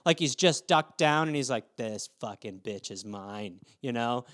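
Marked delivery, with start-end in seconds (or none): 1.8-3.6 low, hushed, slow predator voice; quiet, drawn-out menace; stalking cat. Retold or imitated. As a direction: voice a hunting cat's private threat, very soft and deep. Stalking cat's thought voiced.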